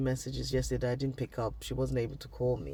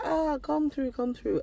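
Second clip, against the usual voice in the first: put-on higher, warm-toned Nigerian auntie welcome in a Nigerian accent; 12 semitones higher than usual. Auntie welcoming niece at church, light Nigerian accent. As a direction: call her over with a Nigerian accent. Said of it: "she just switches to Nigerian accent".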